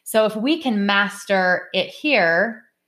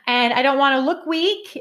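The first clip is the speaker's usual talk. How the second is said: higher, worried self-defence; anxious protest; 6 semitones higher than usual. Retold or imitated. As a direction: protest that you feel weak, anxiously. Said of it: "I go".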